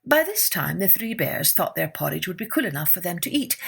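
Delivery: usual speaking voice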